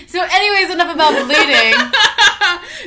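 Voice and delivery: loud, high, laughing wrap-up. Real, not acted. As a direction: laugh it off and move on. Laughing to change topic.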